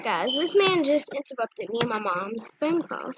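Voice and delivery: ordinary talk, nothing marked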